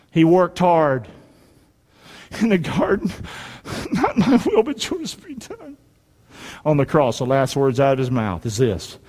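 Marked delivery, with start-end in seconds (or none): 3.9-5.8 agonized prayer. Acted. Preacher as Jesus praying in the garden, agonized and high.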